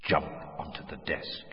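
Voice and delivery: low, soft, far-off command; a voice echoing in an empty mind; distant echoing voice. A narrator's reading. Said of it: "voice echoing in some distant chamber".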